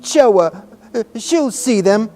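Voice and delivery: high, broken tearful gasps; between sobs. A narrator's reading. Sobbing boy tells nurse to obey, between sobs. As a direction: push words out through sobs. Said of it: "he said between sobs".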